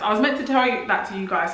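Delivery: ordinary talk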